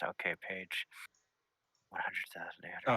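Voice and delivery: whispered, half to himself; old man whispering a page number from the index. Acted. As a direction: read the page number under your breath.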